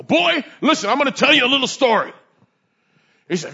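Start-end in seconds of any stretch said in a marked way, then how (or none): 0.0-2.1 a hint of a gruff old officer, quick on the second line; lightly voiced stern mentor lecturing a rookie; gruff training officer. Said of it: "That's the way he talked to me".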